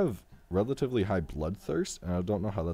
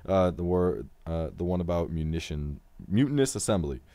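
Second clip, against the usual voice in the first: usual speaking voice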